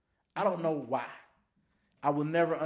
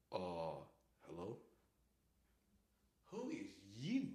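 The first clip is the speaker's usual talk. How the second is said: mild sleepy deep voice; 9 semitones lower, 15 dB quieter than usual. Retold or imitated. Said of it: "It's twelve now".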